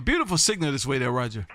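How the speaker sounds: ordinary talk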